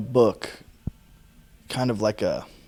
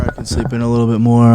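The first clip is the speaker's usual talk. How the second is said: louder sleepy plea; 9 dB louder, faster than usual. Retold or imitated. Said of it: "I completely was like".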